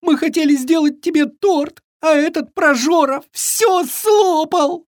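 high-pitched whining wail; tearful complaining. A narrator's reading. Fawn reports the eaten cake to Viking, wailing. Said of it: "начал жаловаться оленёнок, заливаясь слезами".

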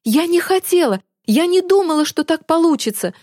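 high-pitched pleading excuse; distressed protest. A narrator's reading. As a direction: plead innocence, backing away from anger. Girl protests, backing away from accuser. (испуганно оправдываясь)

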